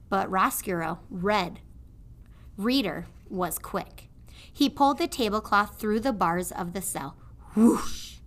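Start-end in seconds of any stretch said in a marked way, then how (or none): none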